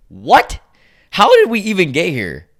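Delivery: loud, high burst of bafflement; real incredulous outburst; baffled disbelief. Real, not acted. Baffled reaction to the thread.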